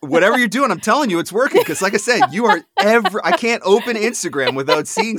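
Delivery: high, loud enthusiastic praise; excited. Real, not acted. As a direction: gush with real excitement.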